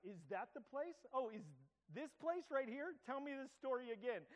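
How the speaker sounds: high, eager teasing voice; mock eager teasing. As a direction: egg him on like a curious kid. Teasing a friend about his ram.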